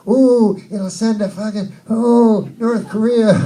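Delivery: high mock panic, hammed up; mocking scared voice. Comic mocking news panic to the crowd. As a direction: squeal it like a frightened pundit. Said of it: "They're like".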